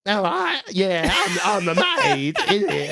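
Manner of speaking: very high, loud maid. Acted. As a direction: squeal it like a silly maid. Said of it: "the one I get told off for is the maid".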